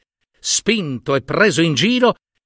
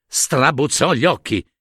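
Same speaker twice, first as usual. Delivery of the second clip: ordinary delivery, nothing marked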